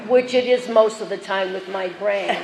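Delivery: normal talking voice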